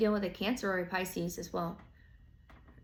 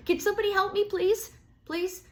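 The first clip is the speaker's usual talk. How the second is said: much higher, louder imitated plea; mock pleading; 12 semitones higher, 6 dB louder than usual. Stuck Capricorn calling to passersby, hammed-up plea. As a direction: call out for help, hammed-up.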